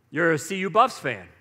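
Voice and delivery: ordinary talk, nothing marked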